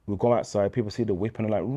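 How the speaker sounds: ordinary talk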